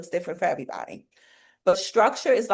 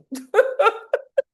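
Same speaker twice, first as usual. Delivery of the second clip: high laugh; 5 dB louder than usual. Real, not acted. Laughs at own insistence.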